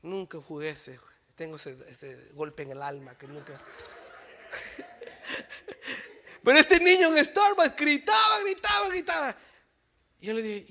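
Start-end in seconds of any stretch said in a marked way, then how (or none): 3.6-6.4 high airy laugh; a soft bitter laugh; breathy laugh. Real, not acted. Laugh after sad memory. 6.4-9.3 loud, much higher repeated words; loud, rising outrage; emphatic shout. Real, not acted. Describing screaming child.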